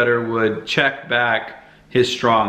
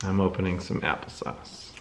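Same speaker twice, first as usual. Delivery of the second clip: ordinary delivery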